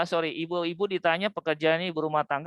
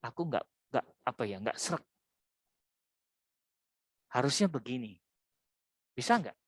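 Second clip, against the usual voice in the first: gentle request; 5 semitones lower, 6 dB quieter than usual. Acted. Ngomong baik-baik.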